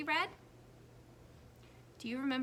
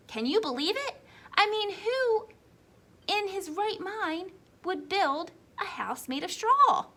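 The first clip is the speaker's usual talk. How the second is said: higher, mocking disbelief; wolf mocking the pig's straw house, higher and incredulous; 9 semitones higher, 8 dB louder than usual. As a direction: scoff at the silly pig.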